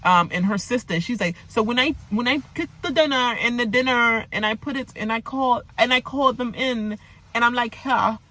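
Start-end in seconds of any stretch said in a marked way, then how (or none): 1.5-8.2 a Jamaican patois attempt that drifts toward a British accent, pitched higher; a botched Jamaican patois that sounds British; patois slipping into a British accent. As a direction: try Jamaican patois and land somewhere near a British accent. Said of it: "it sound like U K now".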